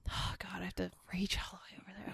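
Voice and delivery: breathy whispered grumble; whispering. Real, not acted. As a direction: whisper it under your breath. Quiet aside while reaching.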